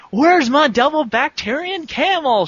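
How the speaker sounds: high, loud desperate cry; desperate shout. Acted. Clark shouting desperately for his lost camel. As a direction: shout it in a panic. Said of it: "shouted Clark desperately".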